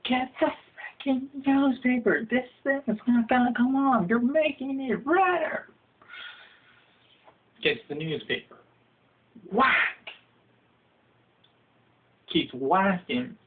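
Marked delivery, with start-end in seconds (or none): 0.1-5.7 high, urgent, breathless orders; panicking while he whacks you; frantic shouting. Acted. Victim yelling at his bro for the newspaper, high and frantic. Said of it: "And you're like". 9.5-10.1 sharp, high mock smack; whack sound effect. Comic voicing the newspaper slap, sharp and high.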